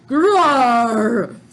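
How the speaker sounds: loud beastly roar; gorilla roar. Acted.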